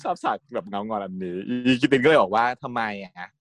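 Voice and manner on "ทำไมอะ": soft slow question. Retold or imitated. Tin's short reply.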